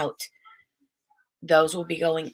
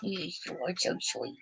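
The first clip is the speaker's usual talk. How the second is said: much softer murmured praise; 10 dB quieter than usual. Real, not acted. Mother murmurs to her baby, soft and tender.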